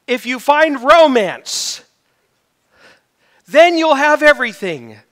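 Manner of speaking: over-the-top hype, stretched words; mock-grand promise. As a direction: belt out the world's false promise. Mocking what the world promises.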